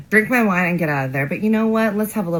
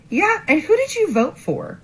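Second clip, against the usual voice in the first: high-pitched, loaded query; spring a leading question, reenacting; retold pointed question; 14 semitones higher than usual. Retold pointed question to her date.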